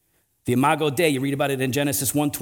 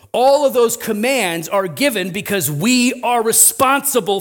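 high, emphatic pulpit voice with drawn-out endings; impassioned sermon climax; forceful preaching; 6 semitones higher, 4 dB louder, slower than usual. Real, not acted. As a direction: drive the conclusion home.

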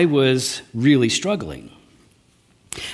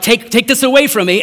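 raised, louder plea to God; pleading cry; 7 semitones higher, 4 dB louder than usual. Retold or imitated. Paul begging God to remove his thorn, raised pleading voice. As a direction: beg God out loud to take it away.

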